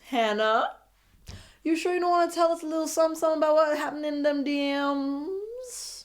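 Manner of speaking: pry for gossip in a hurry; teasing host. Retold or imitated. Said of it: "side-eyeing your girl like".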